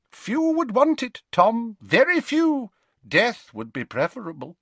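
high, forceful character voice; agitated older professor. Acted. Professor warns Tom few would want it, agitated. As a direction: start high and loud, easing down. Slughorn answers Tom in the story.

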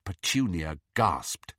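ordinary delivery

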